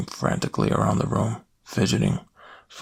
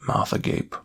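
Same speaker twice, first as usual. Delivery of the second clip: usual speaking voice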